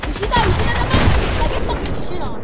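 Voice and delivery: high, loud gloating shout; trash-talking gamer screaming at a victim; taunting yell. Real, not acted. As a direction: rub the kill in his face. Taunting killed enemy.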